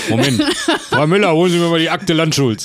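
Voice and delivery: lightly voiced boss, calling his secretary; a hint of a bossy publisher; pompous publisher boss.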